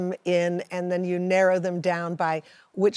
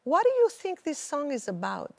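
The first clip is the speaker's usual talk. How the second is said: mom's voice, pitched up and curious; mom's question; 8 semitones higher than usual. Retold or imitated. Daughter voicing her mom asking about the song, lightly higher. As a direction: ask it the way mom asked. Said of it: "And my mom said".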